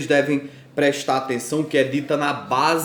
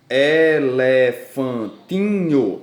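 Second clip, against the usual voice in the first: ELEFANTINHO drawn out slowly, split into syllables; 3 dB louder, much slower than usual. Shown as a demonstration. Teacher counting syllables for fifth-graders, syllable by syllable. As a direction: break ELEFANTINHO into slow, separate syllables. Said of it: "ela possui cinco sílabas".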